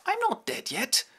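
the father protests he still lives; feeble old count. Acted. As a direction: object from the deathbed.

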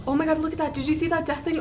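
ordinary talk, nothing marked

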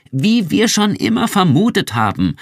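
crowing the point home; triumphant finish. A narrator's reading. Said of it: "beschloss Irmgard ihren Satz".

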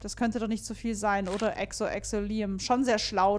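ordinary talk

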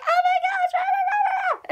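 imitating a very high, gushing fangirl; fangirl squeal. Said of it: "her voice changed".

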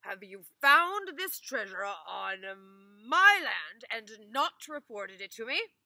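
loud stern accusation; angry master. Acted. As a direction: scold him for hiding treasure. Master interrogates Ivan.

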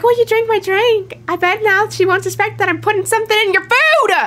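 high, mocking villain voice; creepy man. Gamer mocks the creepy man's plan, high gloating voice. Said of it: "He was like".

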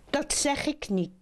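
put-on high-pitched young frog; verliefde jonge kikker. Young frog refusing old frog. (hoge stem van de verliefde jonge kikker)

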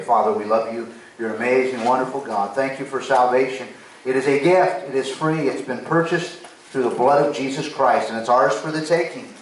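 loud, fervent prayer; public prayer. Real, not acted. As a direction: lead the congregation in thanks to God. Leads closing prayer.

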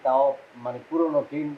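normal talking voice

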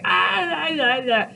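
high mock wail of exasperation; frustrated wail. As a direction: wail like a thwarted girl. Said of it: "She's like".

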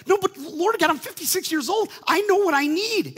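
very high, loud objection; indignant protest. Retold or imitated. Preacher objecting to God, high and indignant. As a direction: insist you know better.

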